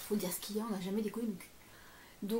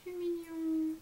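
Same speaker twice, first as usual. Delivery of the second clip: cooing; 8 semitones higher, much slower than usual. Real, not acted. Finds a cute item.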